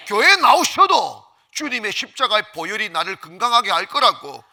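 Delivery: loud, high, driving pulpit voice; ringing, insistent sermon climax; full-force preaching. Real, not acted. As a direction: proclaim it to the whole congregation.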